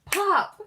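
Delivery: sudden loud, high "Pop!"; imitated thump. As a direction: burst it out like a foot hitting the floor. Imitating rabbit's foot thump.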